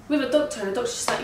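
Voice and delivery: ordinary talk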